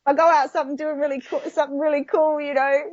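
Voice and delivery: high, light mock bravado; carefree bravado. Stuntwoman quoting her own carefree take on dying. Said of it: "but I'm like".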